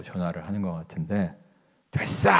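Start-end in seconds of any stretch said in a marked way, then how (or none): 1.9-2.3 abrupt high shout, acting out a patient; angry cut-off. Old patient hangs up on his wife, angry shout. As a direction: imitate an irritated, explosive dismissal. Patient cuts off wife's call.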